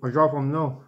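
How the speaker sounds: talking around a mouthful of mints; words mashed by mints in mouth; mouth full of mints. Real, not acted.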